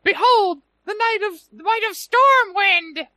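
very high squeaky tiny-guy voice; high-pitched voice. Retold or imitated. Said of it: "this one already has a high-pitched voice".

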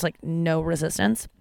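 ordinary talk

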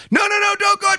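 high shouted plea; hammed-up desperate cry; mock Snake plea. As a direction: wail after the fleeing prisoner. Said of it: "and Snake's like".

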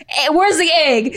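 playful slow indignant question; mock challenge. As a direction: demand proof, laughing. Acted argument over egg rolls.

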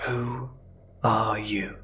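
silent whispered question; mouthed silently. A narrator's reading. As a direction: barely breathe the words out. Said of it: "so with my lips I mouthed silently".

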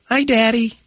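high-pitched imitation of a cart girl; girlish greeting. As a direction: chirp it as a daughter greeting her dad. Voicing the cart girl greeting dad.

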